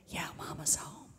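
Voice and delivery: put-on hushed little boy whisper. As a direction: keep it unvoiced and secretive, like hiding. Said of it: "He whispered".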